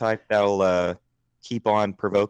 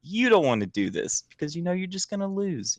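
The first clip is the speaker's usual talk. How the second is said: imitated warning; 7 semitones higher than usual. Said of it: "It's like".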